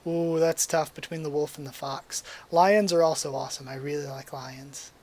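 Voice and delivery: ordinary delivery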